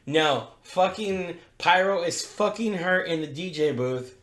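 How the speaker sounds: usual speaking voice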